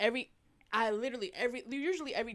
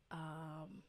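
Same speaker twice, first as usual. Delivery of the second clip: whisper; 2 semitones lower, 13 dB quieter than usual. Retold or imitated. Retold secret prayer attempt.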